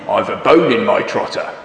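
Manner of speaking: lightly voiced grumbling pig; a hint of a low piggy grumble. Pig excusing himself, with a light piggy voice. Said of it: "says the pig".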